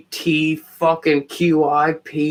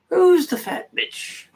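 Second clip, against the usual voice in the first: mocking falsetto; 2 semitones lower, 3 dB quieter than usual. Streamer mocking a chat message in a squeaky voice.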